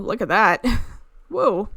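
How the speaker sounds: ordinary talk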